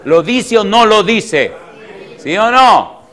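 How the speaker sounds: loud, high preacher's demand; forceful challenge. Real, not acted. Preacher pressing congregation on what scripture says, forceful challenge. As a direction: fire the question out, demanding an answer.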